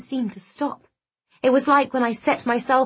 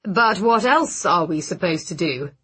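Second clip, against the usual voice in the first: confused; 3 semitones lower than usual. Acted. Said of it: "He sounded confused".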